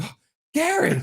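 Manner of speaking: put-on high, thrilled child's call; excited little kid. Dad imitating his son at the doorbell, excited call. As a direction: imitate a small boy squealing a favorite name. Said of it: "he goes like".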